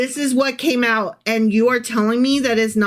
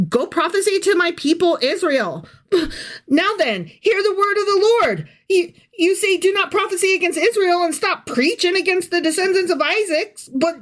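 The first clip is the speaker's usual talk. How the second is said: high, hammed-up preacher voice; mock preacher; 8 semitones higher than usual. Reading scripture in mock voice.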